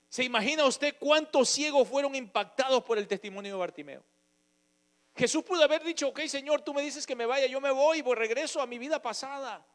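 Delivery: loud, high fervent preaching, slowing on key phrases; preaching at full force. Acted. Preacher telling the congregation how Jesus healed the blind man, fervent. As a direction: proclaim the healing to the church. Preaching about Bartimeo's testimony.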